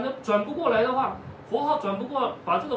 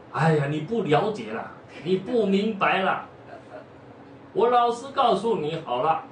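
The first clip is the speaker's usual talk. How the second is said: impatient; 2 semitones higher than usual. Acted. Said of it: "这个法师不耐烦".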